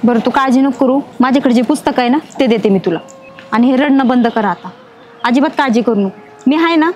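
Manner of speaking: low, gentle comfort; reassuring. Acted. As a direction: daughter comforting the waiter. Daughter comforts crying waiter.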